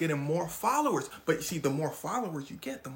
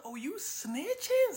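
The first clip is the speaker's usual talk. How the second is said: much higher, slow, indignant; outraged; 13 semitones higher, much slower than usual. Retold or imitated. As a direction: drag it out in disbelief. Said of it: "He said".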